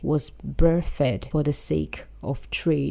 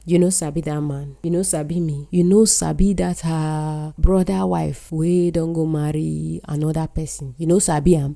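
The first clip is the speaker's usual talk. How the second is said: sentences in Nigerian pidgin, lively rhythm. Shown as a demonstration. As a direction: speak it as Nigerian pidgin, not formal English. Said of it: "words like sabi used in sentences like".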